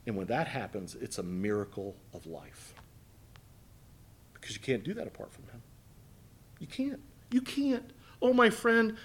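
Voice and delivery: soft reassuring sermon close; tender pastoral hush. Acted. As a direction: lean in and comfort the hurting. Soft intimate pastoral appeal.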